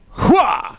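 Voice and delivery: sharp martial-arts shout, hammed up; mock battle cry. Clowning before reading a poem, mock battle cry.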